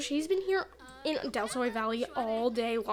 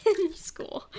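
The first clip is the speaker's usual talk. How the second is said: ordinary talk, nothing marked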